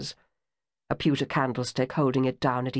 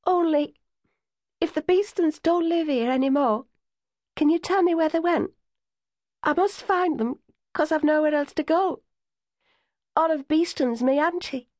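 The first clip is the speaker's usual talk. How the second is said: close to tears; 11 semitones higher than usual. A narrator's reading. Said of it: "her voice cracked under the pressure of suppressed tears".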